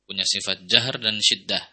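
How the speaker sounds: ordinary talk, nothing marked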